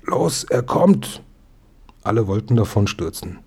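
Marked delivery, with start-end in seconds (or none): 0.0-1.2 high, drawn-out alarm call; slow high call, bellowed without volume; mock bellow. A narrator's reading. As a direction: stretch it out like a held-back yell. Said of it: "hupte laut und brüllte".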